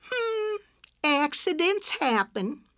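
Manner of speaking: a high, sing-song hum, then slow; smug mock innocence; not even pretending sorry. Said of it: "not even pretending to sound sorry".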